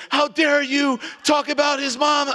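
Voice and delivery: a touch of a high, slow indignant woman; lightly imitating the offended girlfriend; mock outrage, high and slow. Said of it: "he and his girlfriend got in my face".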